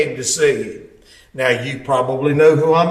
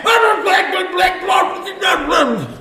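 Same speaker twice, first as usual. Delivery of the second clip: cussing shout; 13 semitones higher, slower than usual. Retold or imitated. Owner cusses him out.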